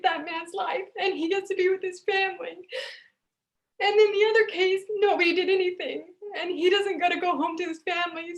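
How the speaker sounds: pushing the words out through real tears; choked up, tearful. Real, not acted. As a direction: paramedic to the class, tearful and choked up. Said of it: "I'm getting choked up".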